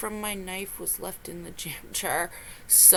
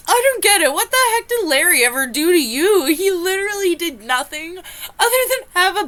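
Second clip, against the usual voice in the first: outraged disbelief; 6 semitones higher, 7 dB louder than usual. Real, not acted. Outraged at the storyteller.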